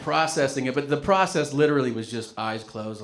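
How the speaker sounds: usual speaking voice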